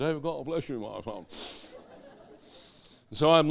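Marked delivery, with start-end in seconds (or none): 0.0-1.3 soft, pious priest impression; mock vicar. Man mimics a priest blessing someone, in a soft mock-pious voice. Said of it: "like the vicar and the priest".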